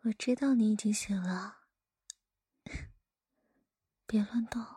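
ordinary delivery

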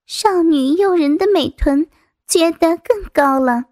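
plain reading voice

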